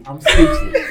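"speechless" squeaked high while laughing; high laughing squeal. Real, not acted.

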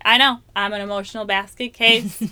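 loud, self-mocking rant; joking self-mockery. Joking to her husband about being a basket case, loud. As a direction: list your woes with comic exaggeration. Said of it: "my joke about it with my husband".

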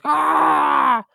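high wordless wail into gibberish; wordless creature sounds. Retold or imitated. Mimicking a character who can't vocalize words, wordless creature cry. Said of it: "just makes these, like, sounds".

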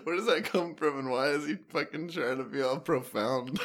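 normal talking voice

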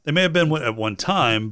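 holding back a hiccup mid-word; suppressing hiccups. Real, not acted. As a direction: cut off by a hiccup. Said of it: "I'm trying to suppress a hiccup here".

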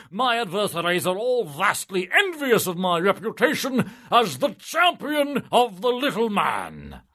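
high, loud, indignant bureaucrat; pompous official. Acted. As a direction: puff up like a smug politician. Pompous civil servant pleads.

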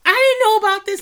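high, loud mock shock; shocked fan. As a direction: gasp it out like the news just hit you. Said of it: "And you're just like".

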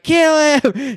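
crowd yell. Retold or imitated. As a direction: yell it like a rowdy jousting fan. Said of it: "We're just screaming".

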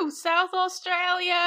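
high, slow whooping cheer; mock South Australia cheer; excited whoop. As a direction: cheer like a party dude on a Vine. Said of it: "the one where it's like".

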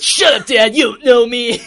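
put-on loud, high rebellious teen shout; hammed-up, high-pitched defiant outburst; Alucard. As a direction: yell it like a sulky skater son. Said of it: "Alucard does a kickflip and says".

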